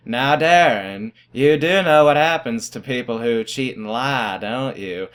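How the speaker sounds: put-on slow, drawn-out twang of an old math teacher; twangy teacher impression. Teacher to student accused of cheating, slow twangy warning. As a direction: imitate a teacher's twangy warning, dropping off at the end. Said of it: "I do a spot-on impression of him".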